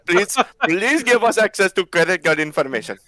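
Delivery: pleading scammer in a mock Indian accent; hammed-up Indian accent; Indian call center scammer. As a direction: put on an Indian tech-support accent. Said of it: "the Enclave Indian call center trying to scam".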